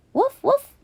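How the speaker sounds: high, bright little woof woof; playful barking. Pretend. Grace barks woof woof to thank her dog. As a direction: bark like a happy puppy.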